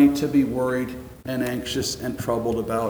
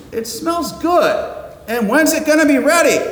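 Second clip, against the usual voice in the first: hungry guest; 11 semitones higher, 3 dB louder than usual. Pretend. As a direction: act the hungry guest who can't wait to eat. Hungry guests eyeing the kitchen.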